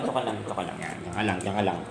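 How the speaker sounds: low, soft repeated reassurance; settling. Real, not acted. Telling the pet to stay put, settling. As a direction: murmur it low to settle them.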